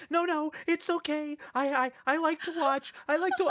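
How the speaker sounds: put-on high, loud frightened child voice; scared kid. Kid in the meme before his shot, high panicky pleading. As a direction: plead like a terrified little boy. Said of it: "He's like".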